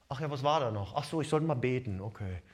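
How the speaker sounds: low, soft and careless, as if it barely matters; lazy, distracted mumble about praying; offhand. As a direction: shrug off prayer as an afterthought. Pastor acts forgetting to pray.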